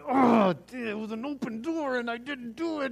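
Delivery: high-pitched, whiny mock lament; mock regret. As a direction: act out kicking yourself over a missed chance. Acting out regret after backing down.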